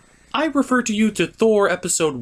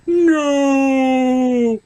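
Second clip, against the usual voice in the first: high, loud cry of no; anguished cry; 9 semitones higher, 6 dB louder than usual. Acted. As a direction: cry out in despair. Toy figure cries out.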